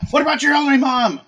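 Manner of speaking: high, loud outraged mother act; indignant mom. Acted. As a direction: wail it like a guilt-tripping mom. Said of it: "exactly how my mom would act".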